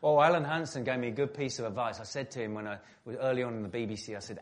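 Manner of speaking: ordinary talk, nothing marked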